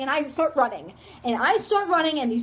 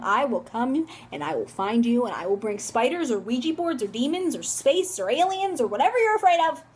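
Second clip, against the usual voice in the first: ordinary talk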